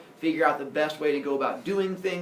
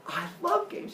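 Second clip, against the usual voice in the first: high, mock-girlish enthusiasm; delighted girlfriend; 10 semitones higher than usual. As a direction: play a girlfriend thrilled by the idea. Voicing the delighted goddess.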